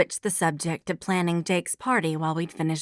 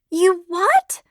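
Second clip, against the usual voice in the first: high, loud disbelieving yelp; you what, in total disbelief; shocked; 12 semitones higher, 7 dB louder than usual. A narrator's reading. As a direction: blurt it out in shock. Shocked at friend's meddling.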